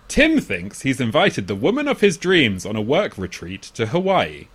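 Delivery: swooping, theatrical trailer-style read; plot synopsis read. Real, not acted. Podcaster reading a film synopsis to co-hosts, theatrical read. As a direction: read it out with big pitch swings.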